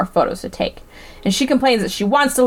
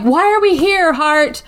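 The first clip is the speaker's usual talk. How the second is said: exasperated plea; 6 semitones higher, slower than usual. Retold or imitated. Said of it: "so she's like".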